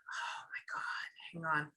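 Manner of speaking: unvoiced whisper to herself; whispered aside. Real, not acted. Medium listening to spirit, whispering to herself. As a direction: breathe it out under the breath. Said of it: "aside while listening".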